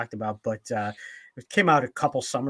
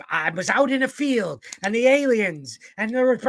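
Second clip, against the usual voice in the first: much higher and louder squawking alien story; Gilbert Gottfried impression; 11 semitones higher, 6 dB louder than usual. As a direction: rant it as Gilbert. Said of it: "he goes on and on".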